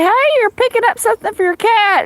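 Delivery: high teasing pharmacist voice; imitating pharmacist. Teasing her in line, a high mock pharmacist voice. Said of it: "the pharmacist would say".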